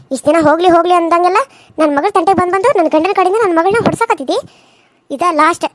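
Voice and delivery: low, hard and fast warning; angry mother. Acted. Mother warning the aunt off her daughter, low and furious. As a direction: threaten her to stay away from your child.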